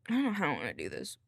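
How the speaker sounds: ordinary delivery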